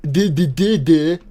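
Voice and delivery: loud goofy duh-duh imitation; mocking dumb voice. Gamer mocks friend's voice as dumb babbling. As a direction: play him like a dimwit. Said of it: "That's what you sound like".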